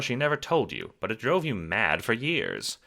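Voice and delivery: ordinary delivery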